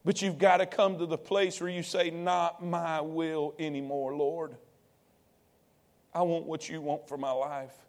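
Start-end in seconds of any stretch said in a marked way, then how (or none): 2.1-7.8 hushed prayer. Acted. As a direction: pray it softly, giving up. Prayer of surrender voiced.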